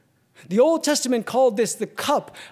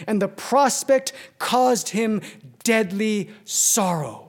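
louder, driving preacher's reading; preaching a quotation; 5 semitones higher, 4 dB louder than usual. Acted. Preacher reading Hugh Martin to congregation, forceful preaching. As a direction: press each phrase of the old quote home.

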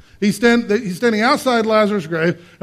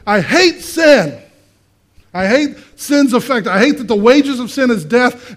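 fierce, raised declaration of hatred; higher-pitched, emphatic outrage, leaning on "hate"; Jesus; 4 semitones higher, 4 dB louder than usual. Acted. Preacher voices Jesus at his friend's grave, vehement. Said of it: "He's going".

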